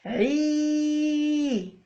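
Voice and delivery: long held عي; drawn-out syllable ʕi. Shown as a demonstration. Teacher modelling the kasra syllable. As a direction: hold عي out steady. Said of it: "والحرف العا تولي".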